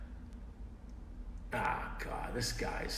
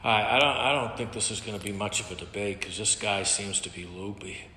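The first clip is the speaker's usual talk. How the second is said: normal talking voice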